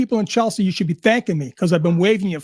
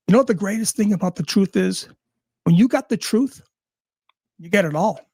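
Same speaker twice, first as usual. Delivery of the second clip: ordinary delivery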